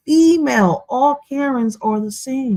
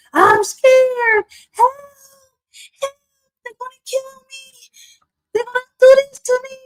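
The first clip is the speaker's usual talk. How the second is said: mock scared Karen. Mocking Karens who scream that they are scared, in high frantic panic. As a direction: shriek for help like a terrified Karen. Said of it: "they scream and they shout".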